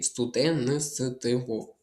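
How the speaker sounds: Студентство drawn out slowly, each sound of -нтство audible; slow spelled-out pronunciation. Shown as a demonstration. Teacher slowly spelling Студентство aloud for the class. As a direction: stretch Студентство out so every sound is heard. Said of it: "Слово студент закінчується на ти".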